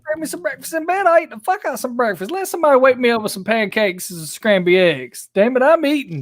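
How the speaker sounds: high whiny demand for a sandwich; mock demanding wife. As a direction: whine it like a bossy wife. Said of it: "She said".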